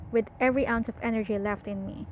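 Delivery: usual speaking voice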